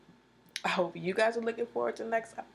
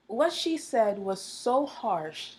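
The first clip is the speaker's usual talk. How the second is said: ordinary talk, nothing marked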